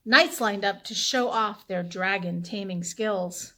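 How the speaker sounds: ordinary delivery, nothing marked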